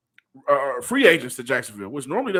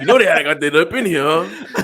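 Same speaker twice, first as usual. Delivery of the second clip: laughing; 2 semitones higher, 8 dB louder than usual. Real, not acted. Hosts laughing together.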